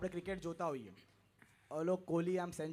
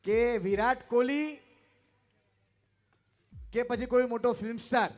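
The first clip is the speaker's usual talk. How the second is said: loud, higher proclamation to the hall; stage announcer; 4 semitones higher, 9 dB louder than usual. Acted. Host repeating the initiate's answer to the crowd, loud proclamation.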